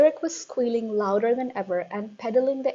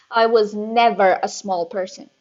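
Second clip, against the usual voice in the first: loud, snapping retort; snapped; 8 dB louder than usual. A narrator's reading. Headmistress snapping at a brave boy, loud. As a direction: snap it back at the boy. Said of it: "she snapped".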